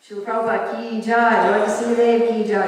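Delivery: ringing closing cheers, held on the last; devotional acclamation. Real, not acted. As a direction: call out to rouse the congregation. Closing congregational call of praise.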